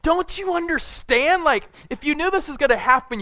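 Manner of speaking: mock-frantic plea to Jesus; freaking out. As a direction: whine it in a hammed-up panic. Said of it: "they're freaking out".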